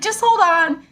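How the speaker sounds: put-on high, bright-eyed kid reassuring a grown-up; little kid voice. Kid on the ride telling her she'll be fine, high child voice. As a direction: pitch way up like a confident child. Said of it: "he's like".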